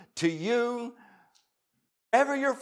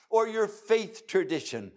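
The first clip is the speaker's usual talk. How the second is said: usual speaking voice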